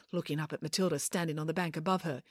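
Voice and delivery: usual speaking voice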